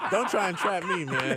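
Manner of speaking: laughing protest. Real, not acted. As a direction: laugh through the words. Laughing off a trap question.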